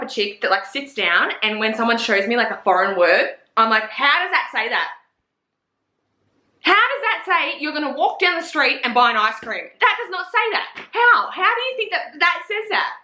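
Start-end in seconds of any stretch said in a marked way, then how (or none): 3.9-12.9 climbing much higher in mock disbelief; incredulous, rising to a squeak; baffled disbelief. Staring at a foreign word, hammed-up incredulous higher voice.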